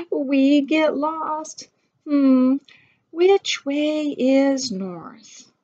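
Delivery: slow puzzled. Acted. Characters lost on trail.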